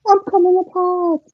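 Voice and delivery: high, loud mock cry of alarm; exclaiming. Friend imitating Scruffy falling to pieces, exclaiming. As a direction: cry it out high like a cartoon character. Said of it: "Scruffy's about to exclaim out".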